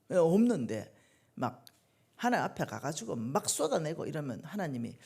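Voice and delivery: ordinary delivery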